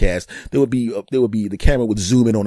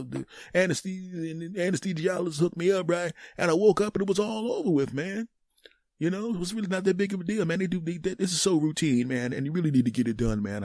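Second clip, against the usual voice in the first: higher-pitched casual buddy voice; reassuring older friend; 7 semitones higher, 3 dB quieter than usual. Acted. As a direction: reassure him as one who's been through it. Plays a friend who had one.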